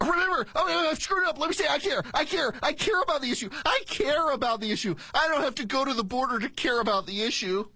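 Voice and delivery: high, hammed-up flustered insistence; flustered Veep parody. Host parodying her defending herself, mock flustered insistence. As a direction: scramble to recover, overprotesting each "care". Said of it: "this is like something out of Veep".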